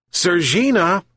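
high-pitched call for a cat; mild shout. A narrator's reading. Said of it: "shouted the old fisherman".

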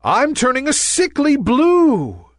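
high, dismayed little cloud; alarmed wail. A narrator's reading. Nimbus seeing himself turn blue, alarmed. Nimbus panics at changing colour.

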